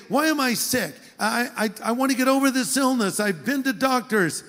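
plaintive complaining voice. Retold or imitated. Preacher imitating a sick person asking why, plaintive. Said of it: "you might say".

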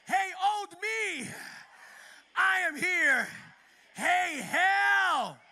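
loud, high, shouted preaching; full-force preaching. Acted. Preacher calls out to congregation.

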